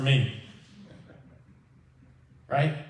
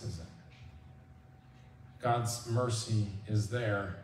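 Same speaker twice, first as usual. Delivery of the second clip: ordinary talk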